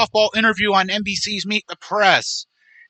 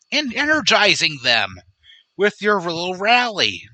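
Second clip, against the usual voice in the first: ordinary delivery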